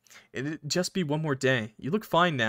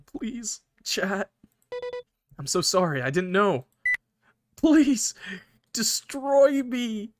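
high, strained, drawn-out begging; agonized plea; 7 semitones higher, slower than usual. Acted. Button in agony begging to be destroyed, high and slow. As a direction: stretch the last words out in pain.

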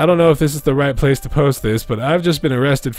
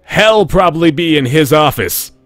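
with attitude; 5 semitones higher, 6 dB louder than usual. Acted. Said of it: "She's saying that with attitude".

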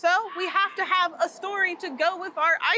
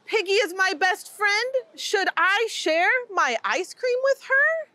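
ordinary delivery

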